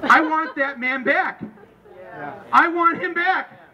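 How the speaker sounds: high, slow, emphatic cry; rallying demand. Real, not acted. Speaker rallying the crowd for Obama, high emphatic demand.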